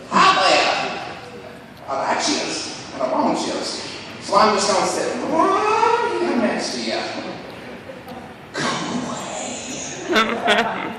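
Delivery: high, slow, breathy anxious voice; comic's roommate's friend, nervously pushy; creepy close talker. Retold or imitated. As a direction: play the guy who sits too close. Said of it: "as he goes".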